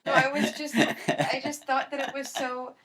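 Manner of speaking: high, loud, laughing through the words. Real, not acted. Laughing while telling friends about the dress meltdown. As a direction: laugh at her own silliness. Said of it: "I was having a meltdown over that".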